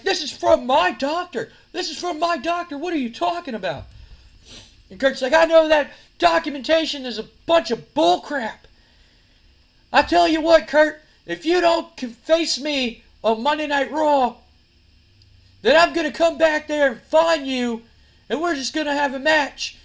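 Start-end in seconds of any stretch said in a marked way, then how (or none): 0.0-3.8 high-pitched defensive protest; indignant protest. Acted. Accused rival protesting. 5.3-19.8 high, loud wrestling promo; angry threat. Acted. Acted wrestler threatening his rival.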